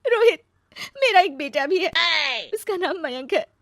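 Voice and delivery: loud, high wail easing off; acted tearful, high outburst; crying. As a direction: sob out a painful confession. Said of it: "रो क्यों रही हो".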